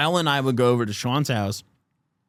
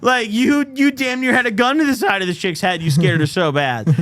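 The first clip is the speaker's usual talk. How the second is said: high, loud, outraged disbelief; incredulous yelling; 8 semitones higher, 4 dB louder than usual. Retold or imitated. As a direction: blast him with loud disbelief. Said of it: "I'm like".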